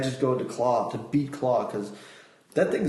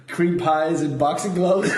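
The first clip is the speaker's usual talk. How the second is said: laughing; 5 semitones higher, 5 dB louder than usual. Real, not acted. Laughing at own joke.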